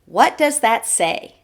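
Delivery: ordinary delivery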